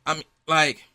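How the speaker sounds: normal talking voice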